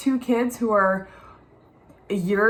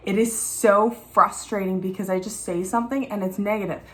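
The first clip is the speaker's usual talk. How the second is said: usual speaking voice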